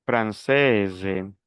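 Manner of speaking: "française" falling to a voiced z; sounded S. Shown as a demonstration. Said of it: "las dos primeras pronunciaciones son normales". ("française" pronunciando la s)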